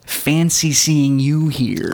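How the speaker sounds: very slow, smooth Slater drawl. Retold or imitated. Friend imitating a grocery store run-in, slow and suave. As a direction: stretch it out like a cool rebel.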